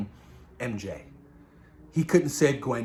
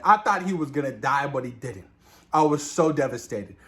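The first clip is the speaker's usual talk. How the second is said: usual speaking voice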